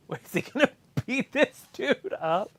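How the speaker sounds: high-pitched, laughing quote; laughing mock worry. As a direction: voice jumps up, breaking into laughter. Acts out crew's amused worry.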